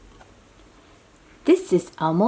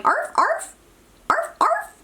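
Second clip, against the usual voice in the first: much higher, dainty yapping; poodle barking. Acted. Poodle with a bow barking, high arfs. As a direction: give tiny prissy poodle arfs. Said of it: "and it's going".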